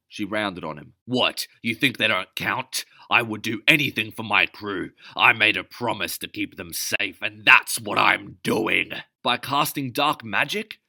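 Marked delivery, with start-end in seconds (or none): 1.1-8.9 captain defending her crew loudly; angry shouting. Acted. Captain rounds on him angrily. 9.2-10.8 high, loud, mocking; scornful scoff. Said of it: "Elvin scoffed again".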